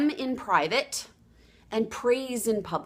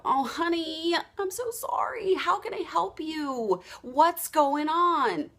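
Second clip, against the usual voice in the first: sympathetic; 6 semitones higher, slower than usual. Retold or imitated. Coach consoling a client who can't lose weight, tender. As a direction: coo gently to a hurting friend.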